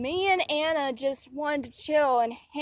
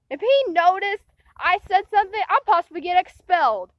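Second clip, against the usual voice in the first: normal talking voice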